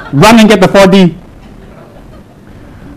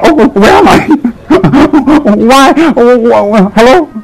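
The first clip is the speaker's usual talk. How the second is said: high, bewildered little voice, looking around; mock confused calling out, high and lost; bewildered newborn lama; 6 semitones higher than usual. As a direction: act dropped into the world, lost and confused. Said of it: "and I'm like".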